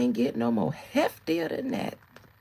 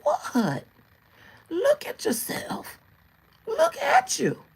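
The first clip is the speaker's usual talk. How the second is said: put-on much higher, louder innocent old-lady squawk; shady old lady; 5 dB louder, slower than usual. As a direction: play dumb, loud and shrill-pitched. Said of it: "they always act like they ain't said nothing shady".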